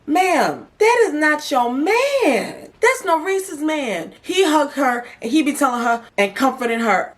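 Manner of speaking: very high, drawn-out disbelief; exasperated scolding. Real, not acted. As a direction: set her straight, stretching the words. Scolds contestant over another's man.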